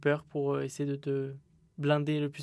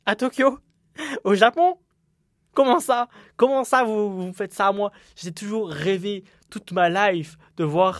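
much higher and louder, outraged disbelief; mock outrage; 6 semitones higher, 9 dB louder than usual. Podcaster to listeners about the Japan event, high loud mock outrage. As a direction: squeal it like a betrayed fan.

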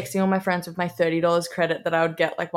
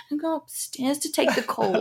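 high, softer put-on protest; mock teen defiance; 6 semitones higher, 5 dB quieter than usual. Teen telling dad she's taking the call upstairs, mock defiance. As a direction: slip away with a pitched-up, hushed insistence.

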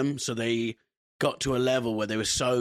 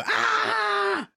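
very high, loud mock scream; imitated fan scream; 5 dB louder than usual. Imitating people who come to the shows, mock screaming. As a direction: scream like a crowd of fans. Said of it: "they scream a lot".

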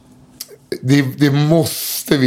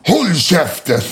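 mock yell; 5 semitones higher, slower than usual. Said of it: "skriker han bara".

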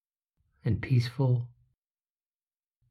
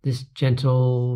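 plain reading voice

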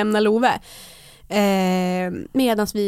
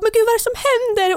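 high, quick panicked question; imitated frantic despair; 12 semitones higher, 4 dB louder, faster than usual. Imitating her friend as the waters break in the car, frantic. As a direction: mimic a friend losing it, pitched right up. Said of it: "Linn blir ju helt förtvivlad".

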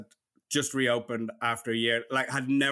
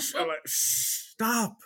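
slow, higher, hushed mock shush; 8 semitones higher, much slower than usual. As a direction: act out friends hushing the table. Friends shushing each other in restaurant.

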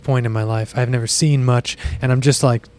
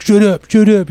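louder, higher shut up; mock protest; 8 semitones higher, 5 dB louder than usual. [name] telling friends to stop, loud mock protest. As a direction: swat the teasing away.

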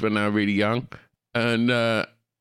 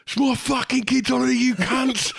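high, mock-furious yell; angry dad. Retelling angry dads at the cancelled parade, mock yelling. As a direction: rant like an outraged dad. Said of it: "dads going".

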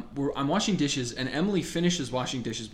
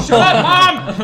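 much higher, louder laughing yell; mock yell; 14 semitones higher, 15 dB louder, much slower than usual. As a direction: yell back at mom while laughing. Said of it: "I'm like".

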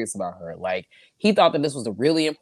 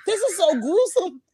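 much higher, imitating her own shock; mock horror; 4 dB louder than usual. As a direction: squeal it in disgusted disbelief. Reacting in horror to film.

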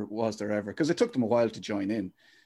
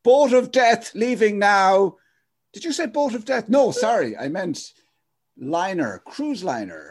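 hammed-up boarding call; 8 semitones higher, 6 dB louder, slower than usual. Mock harbour boarding call.

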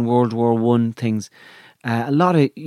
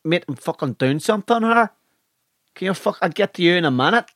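higher, put-on irritated northern accent; aggressive northern barman; 4 semitones higher than usual. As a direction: snap at a customer as a hammed-up northern barman. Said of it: "They're all very aggressive".

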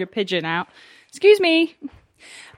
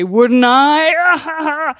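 loud, high mock farmer retort; hammed-up farmer's comeback in banter; other farmer; 7 semitones higher, 6 dB louder than usual. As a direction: throw it back loudly as a teasing farmer. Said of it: "the other farmer would say".

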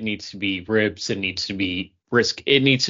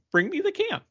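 very high mock demand for the can. Mocking the chef, demanding the can in a high voice. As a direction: squeak it like a spoiled kid.

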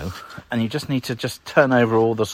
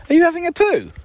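teasing pet talk; 6 dB louder than usual. Real, not acted. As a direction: coo at the dog in a squeaky pet voice. Talking to the dog.

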